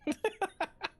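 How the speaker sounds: high-pitched giggling burst; real laugh. Real, not acted. Streamer teasing a viewer, high real laugh. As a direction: break into a quick, high giggle.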